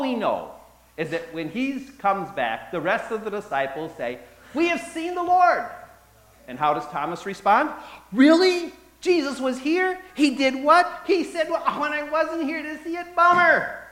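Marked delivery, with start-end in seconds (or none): 4.5-5.6 high, loud, eager exclamation; excited announcement. Acted. Disciples tell Thomas, joyfully calling out. 8.1-13.9 high, loud, flippant mock reply; mock casual Thomas. Preacher jokingly voices Thomas as flippant and high. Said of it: "No, that's not what he says".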